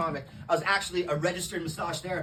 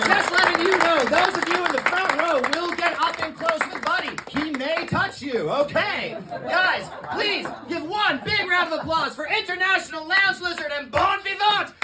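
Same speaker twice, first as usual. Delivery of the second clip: loud, higher MC hype voice; announcer intro; 8 semitones higher, 8 dB louder than usual. Acted. Comic as emcee introducing Buddy to the crowd, loud hype. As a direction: announce the act like a club emcee.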